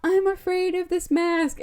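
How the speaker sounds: high put-on child voice; mock-fearful small child; scared little kid. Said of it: "The little kid is like".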